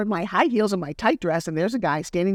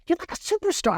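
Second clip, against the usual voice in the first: high, gushing admiration; excited friend; 13 semitones higher than usual. Retold or imitated. Friend gushing to her about being a superstar, high and excited. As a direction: lift it up like meeting a star.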